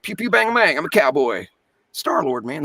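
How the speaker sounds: playful Southern cowboy voice; light Southern accent, boyish shootout noises; Chris Pratt. Shown as a demonstration. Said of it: "little bit of Southern accent".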